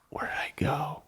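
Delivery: breathy self-question whisper; whispered to himself. Real, not acted. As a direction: whisper it while trying to remember. Muttering to himself, recalling.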